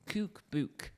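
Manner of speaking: slow attempt at a Geordie accent; badly faked Geordie. Shown as a demonstration. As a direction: say it with long Geordie "oo" vowels. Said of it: "in a Geordie accent".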